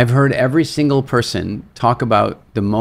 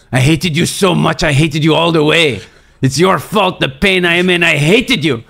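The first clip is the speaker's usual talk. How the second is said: higher, louder retold angry accusation; really angry; 6 semitones higher, 4 dB louder than usual. French climber blames the seminar leader for his pain, angrily. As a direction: act out his anger, building higher. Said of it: "he's really, like, angry".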